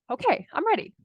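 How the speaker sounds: high-pitched little announcement; acted readiness. Wife telling husband she can chat now, acted readiness. Acts herself finally ready to talk.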